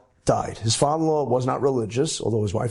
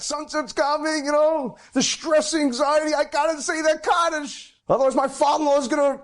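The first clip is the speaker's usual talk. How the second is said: anxious rush; 13 semitones higher than usual. Retold or imitated. Panic racing to sunset.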